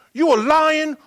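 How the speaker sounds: high, loud call to his little son; playful father hype. Retold or imitated. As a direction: pump up the toddler like a coach. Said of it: "father's call".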